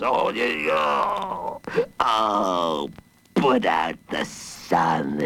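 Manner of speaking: low, drawn-out groaning monster voice; slow, woeful low mumble; mumbling villain. A narrator's reading. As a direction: groan and mumble like a dazzled brute. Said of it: "he mumbled".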